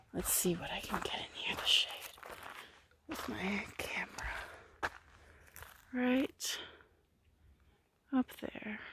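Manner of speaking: hushed whisper near birds. Real, not acted. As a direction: keep it to a breath.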